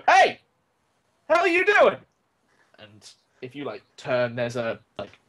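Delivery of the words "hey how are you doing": high, loud startled challenge; calling out. Acted. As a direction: shout it at someone who shouldn't be there. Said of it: "you hear this voice go".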